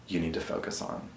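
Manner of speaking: soft, near-whispered reminder; hushed self-encouragement; gentle self-talk. Real, not acted. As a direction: tell yourself gently under your breath. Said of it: "give myself the grace of saying".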